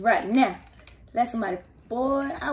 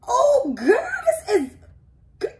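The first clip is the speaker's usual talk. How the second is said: excited squeal; 12 semitones higher, 5 dB louder than usual. Real, not acted. Gift reaction.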